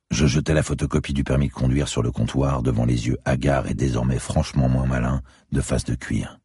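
plain reading voice

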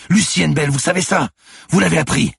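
high, loud, pleading yells; screamed, urgent reminder; insistent shouting. A narrator's reading. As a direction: shout to jog her memory. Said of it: "hurla le lieutenant".

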